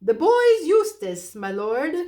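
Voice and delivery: high, slow, loud hooting. Acted. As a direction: hoot it as loud as possible. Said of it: "hooted the owl as loud as he could".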